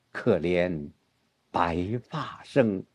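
low, soft, very slow verse; turned to sorrow. Shown as a demonstration. Teacher shows where ambition turns to despondency, subdued and slow. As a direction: let it sink softly, each word drawn out. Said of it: "很自然的就是".

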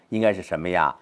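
usual speaking voice

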